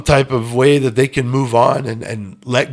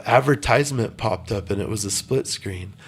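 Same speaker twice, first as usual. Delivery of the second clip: ordinary delivery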